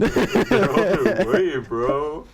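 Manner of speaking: high, loud, laughing; laughing taunt. Retold or imitated. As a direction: crack up while teasing.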